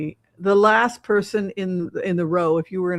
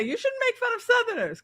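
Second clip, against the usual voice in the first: high, prissy scolding voice; high mock scolding voice; mocking a critic; 14 semitones higher, 4 dB quieter than usual. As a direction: imitate the scold lightly. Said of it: "I had someone say".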